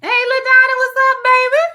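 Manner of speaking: loud, high greeting across the room; calling out. Real, not acted.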